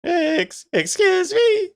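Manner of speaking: very high shrieking falsetto lady, laughing; outraged squeaky woman, loud. Pretend. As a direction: screech it like an offended lady. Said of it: "Let's hear your lady voice".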